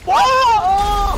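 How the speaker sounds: loud high startled whoa; startled shout. Real, not acted. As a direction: cry out in surprise. Sudden crash on screen.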